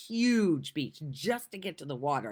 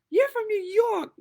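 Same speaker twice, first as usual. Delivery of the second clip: excited exclamation; 9 dB louder than usual. Retold or imitated. Exclaiming to the man on the beach.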